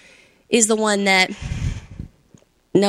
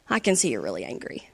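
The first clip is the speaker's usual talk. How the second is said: much lower and softer, soothing; calm reflecting; 9 semitones lower, 8 dB quieter than usual. Shown as a demonstration. Parent calming an angry child, soft and low. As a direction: meet the angry child gently. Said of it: "because you said".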